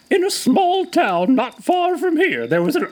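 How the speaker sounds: put-on high quavery old lady voice; sweet old lady. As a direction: tell it like an eager grandma. Missus Butterchurn character.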